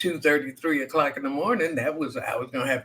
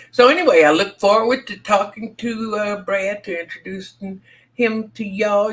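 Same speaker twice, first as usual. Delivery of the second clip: excited; 8 semitones higher, 6 dB louder than usual. Real, not acted. Host excitedly hyping next guest.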